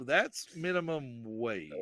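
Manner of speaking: slow, flat realization; slow deadpan. Retold or imitated. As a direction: drag each word out in disbelief. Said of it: "we're like".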